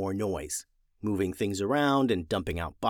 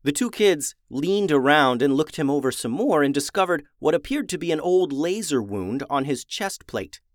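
usual speaking voice

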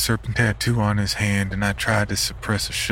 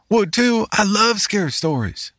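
put-on high, louder excited child holler; 4 dB louder than usual. Youngest boy jumping off the bench, hollering high and loud. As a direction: shout it out like an eager kid.

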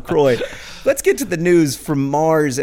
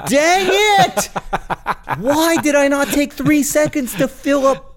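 self-scolding; 14 semitones higher than usual. Retold or imitated. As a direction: yell at yourself for forgetting, half laughing. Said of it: "and I'm like".

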